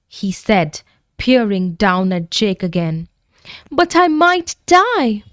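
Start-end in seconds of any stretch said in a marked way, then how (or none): 3.7-5.2 put-on crying child. A narrator's reading. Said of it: "he couldn't help crying".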